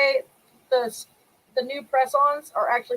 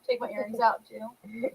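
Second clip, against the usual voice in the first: ordinary delivery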